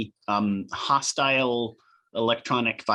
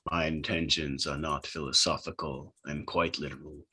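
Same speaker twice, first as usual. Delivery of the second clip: low, softly intoned entity voice; attempted booming voice; 7 semitones lower, 7 dB quieter than usual. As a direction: answer low and quiet as the mysterious transmission. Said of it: "you hear in a booming voice".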